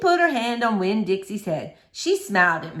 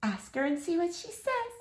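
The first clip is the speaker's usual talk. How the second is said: soft hushed urging; whispered; 9 semitones higher, 9 dB quieter than usual. Acted. Miss Franny urging the girl in the library, hushed. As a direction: nudge her quietly. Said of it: "Miss Franny whispered".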